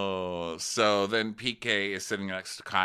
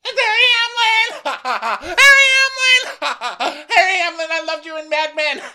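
shrieking fangirl; 12 dB louder than usual. Pretend. Co-host shrieking at Harry's arrival. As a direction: scream his name like a starstruck fan. Mock screaming fan.

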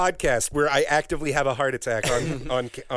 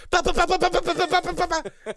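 high-pitched rapid "ba-ba-ba" vocal riff; frantic game-music imitation; 12 semitones higher, faster than usual. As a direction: mimic a hyper rhythm game with high nonsense syllables. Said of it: "kind of energy to it".

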